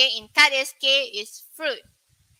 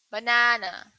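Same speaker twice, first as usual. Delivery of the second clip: ordinary delivery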